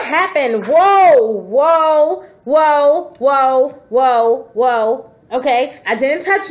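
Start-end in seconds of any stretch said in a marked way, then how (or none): 0.7-5.1 loud repeated whoa, falling away; stunned string of whoas; startled whoa. Real, not acted. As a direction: react to something jumping out at you. Card jumps out, spooked.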